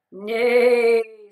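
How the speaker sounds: loud whinnying neigh; a loud horse neigh. Acted. Horse answers Duck.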